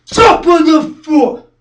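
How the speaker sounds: slow, high, very loud shout; drawn-out yell, hammering the target; shouted repeat. Acted. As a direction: drive every word home at full force. Repeated harder.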